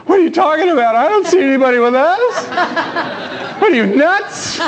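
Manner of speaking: high, disbelieving servant voice; incredulous servant. A narrator's reading. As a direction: scoff at the prophet's claim. Servant doubts the prophet.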